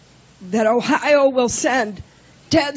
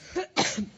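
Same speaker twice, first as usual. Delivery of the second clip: chesty cough; 12 dB quieter than usual. Real, not acted. Coughing fit during speech.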